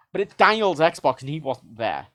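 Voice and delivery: normal talking voice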